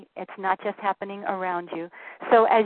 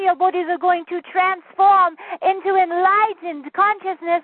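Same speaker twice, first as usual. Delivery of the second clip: a channeled guide blessing the gathering; channeled spirit voice; 7 semitones higher, 5 dB louder than usual. Acted. Channeled guide blessing dear ones, lofty preaching voice.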